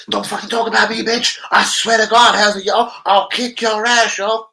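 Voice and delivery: D T threatening Hazard, hammed-up rage; mock angry threat. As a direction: bark it like a furious hard man at the door. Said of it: "D T must have bust open Hazard's door".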